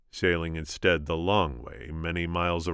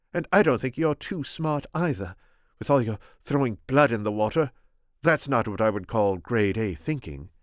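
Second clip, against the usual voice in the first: put-on higher, scornful young woman; defiant princess; 6 semitones higher, 3 dB louder than usual. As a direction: needle him with contempt. Buttercup mocking the Sicilian.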